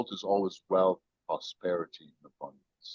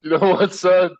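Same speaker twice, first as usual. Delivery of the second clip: mock King George shout; 10 semitones higher, 8 dB louder than usual. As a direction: ham it up, rising on son. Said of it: "He goes".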